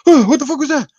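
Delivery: high, startled yelp with a laugh; jolted awake and freaked out; startled wife. Retold or imitated. Wife woken by a fart, startled high cry. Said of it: "and she's like".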